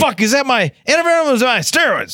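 high-pitched mock panic; panicked driver. Acting out the driver hunting for his antivenom, mock panic. Acts out confused snakebitten driver.

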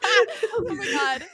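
high laughing oh my God; slow, breaking into giggles. Real, not acted. Embarrassed laughing at plush reveal.

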